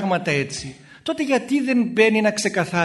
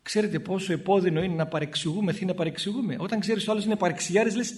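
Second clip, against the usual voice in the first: ordinary talk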